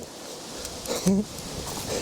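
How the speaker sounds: breathy, voiceless chuckle; short, wordless laugh under the breath. Real, not acted. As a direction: laugh softly to yourself. Speaker chuckles at own remark.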